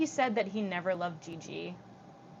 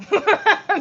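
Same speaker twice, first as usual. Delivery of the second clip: loud laugh; 11 semitones higher, 12 dB louder than usual. Real, not acted. Laugh burst.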